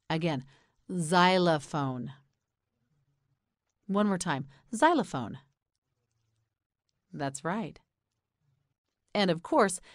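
ordinary talk

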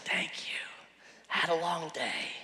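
imitated drowsy whisper; sleepy murmur. Wife half asleep thanks her husband, drowsy whisper.

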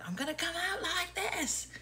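a burst of full outdoor volume; loud outside voice. Shown as a demonstration. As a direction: show how big it would come out. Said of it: "if I don't speak in a quiet voice".

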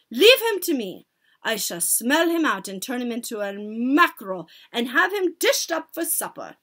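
loud, imperious witch; announcing witch. A narrator's reading. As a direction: declare it with loud authority. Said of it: "announced the Grand High Witch".

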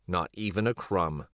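ordinary delivery, nothing marked

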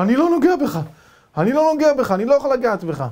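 high, loud panicked retreat; frightened. Retold or imitated. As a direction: back away in terror. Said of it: "התחיל ללכת אחורה מפחד".

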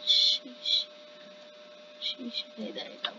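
breathy whispered sheesh. Real, not acted. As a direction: breathe it, barely voiced. Unvoiced sheesh.

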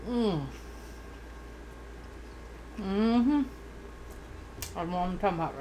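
speaking while biting bread; muffled through food; mouth full of bread. Real, not acted. Seen 1: Garlic bread held at mouth from 0.1, bitten from 1.3 on.

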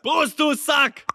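high, slow rowdy toast; rowdy party animal. Real, not acted. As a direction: yell it back cheerfully. Drunken toast reply.

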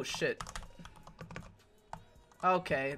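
ordinary delivery

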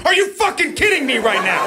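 high-pitched, hammed-up outrage; exasperated outburst. Dad pushed past "damn it" by kids, exasperated. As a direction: blow up in comic disbelief, voice climbing high. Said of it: "bring a situation from".

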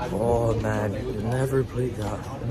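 normal talking voice

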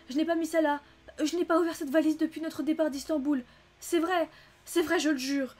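high, loud, frantic pleading; panicked cry. A narrator's reading. Maid swearing to the detective she is innocent, panicked cry. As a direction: plead your innocence at the top of your voice. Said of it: "se changea en affolement".

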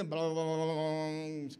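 low, trailing grumble imitation; mock grumbling. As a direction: run the blahs together in a sinking drone. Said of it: "keep on grumbling".

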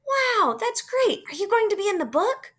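put-on high, excited kid voice; impressed. Classmate congratulates a record-breaker, thrilled and impressed.

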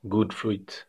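normal talking voice

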